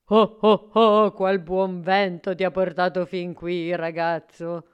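put-on booming jolly old man; big, hearty Christmas voice; Babbo Natale. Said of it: "si trovò di fronte proprio Babbo Natale, che gli disse". (voce di Babbo Natale allegra)

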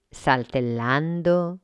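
usual speaking voice

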